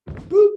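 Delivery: high, loud little boop; a sudden squeaky-high "boop"; playful boop. Real, not acted. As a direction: pop out a high-pitched boop. Cue sound for jumping for joy.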